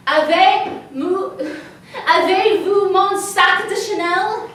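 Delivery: slow, high, laboured French question; halting French. Acted. Tourist attempting French to find her bag, halting French. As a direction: spell it out haltingly for the stranger.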